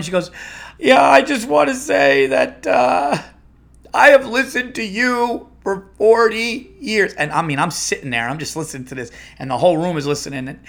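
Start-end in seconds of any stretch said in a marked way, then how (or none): 0.8-7.1 high, loud, drawn-out mock wail; hammed-up tearful radio caller, slow and high; distraught caller. Longtime listener phones the retiring host, mock distraught.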